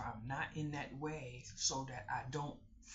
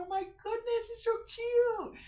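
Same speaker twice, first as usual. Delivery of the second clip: much higher, gushing and cooing; gushing over cute dog; 8 dB louder than usual. Retold or imitated. Telling the neighbor her tiny dog is cute, gushing high. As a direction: coo like you just saw a puppy.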